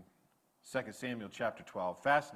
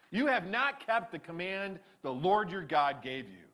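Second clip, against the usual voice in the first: loud, raised prophet pronouncing judgment; stern prophet's rebuke; 10 semitones higher, 7 dB louder than usual. Retold or imitated. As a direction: scold the king from on high. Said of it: "Samuel exclaimed".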